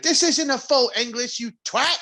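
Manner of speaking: high, loud hammed-up British rant; mock British complaint. Complaining to sister in mock British anger. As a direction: scold like an outraged Brit. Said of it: "just be like".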